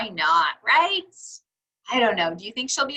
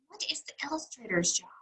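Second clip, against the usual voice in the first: quick secretive whisper to the class; 10 dB quieter, much faster than usual. Acted. Teacher whispering a quiz question to the children. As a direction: lean in and whisper the question fast.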